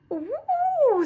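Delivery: very high squeaky "ooh" of tiny bees; chorus of little bees. A narrator's reading. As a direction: coo like a crowd of little bees. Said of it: "said all the little bees".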